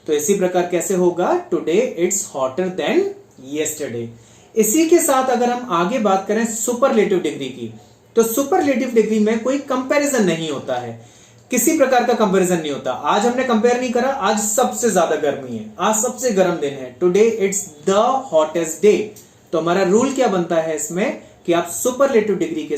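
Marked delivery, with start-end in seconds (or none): 1.5-4.1 slow and deliberate, word by word; teacher's slow example read; slow dictation. Shown as a demonstration. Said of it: "teacher repeats example sentence". 17.0-19.0 slow and deliberate, word by word; teacher's slow example read; slow dictation. Shown as a demonstration. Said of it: "teacher dictates example sentence".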